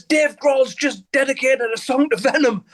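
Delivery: breathless thrill of the news; excited news. Retold or imitated. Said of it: "He says".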